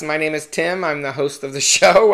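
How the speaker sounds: ordinary talk, nothing marked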